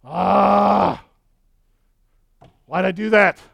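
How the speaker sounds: mock regret. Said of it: "you're like".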